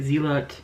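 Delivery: "Zealot" as ZEE-lot, falling; "Zealot" pronounced ZEE-lot, like a statement; trying a pronunciation. Shown as a demonstration. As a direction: try "Zealot" with a full LOT ending. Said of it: "Is that how you say it?".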